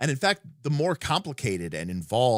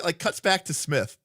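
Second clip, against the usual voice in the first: ordinary talk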